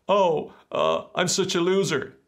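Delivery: high, far-off whiny loser voice; whiny self-pity. Shown as a demonstration. Coach acting a sulky inner voice, high and whiny. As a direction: moan it like you pity yourself.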